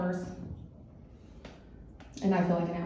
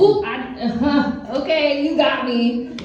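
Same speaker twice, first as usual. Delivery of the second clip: mock caught out; 4 semitones higher, 7 dB louder, slower than usual. Caught by aunt, sheepish.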